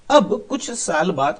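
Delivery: plain reading voice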